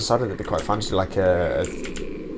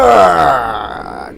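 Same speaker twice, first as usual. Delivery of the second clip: triumphant yell; 6 semitones higher, 12 dB louder than usual. Real, not acted. Winning moment in game.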